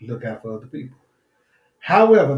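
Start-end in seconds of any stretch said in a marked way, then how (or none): 1.8-2.4 high, loud pivot word; loud emphatic however. Real, not acted. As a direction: punch the turn loudly. Preacher punches the turn.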